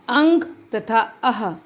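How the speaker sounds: अंग with a nasal ng and अह with a breathy h, both spoken slowly; slow sound demo. Shown as a demonstration. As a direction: spell out अंग and अह slowly and carefully. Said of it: "ये हैं अयोगवा". (धीरे-धीरे, अंग और अह की ध्वनि स्पष्ट करते हुए)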